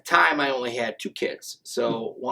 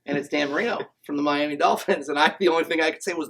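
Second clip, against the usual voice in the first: usual speaking voice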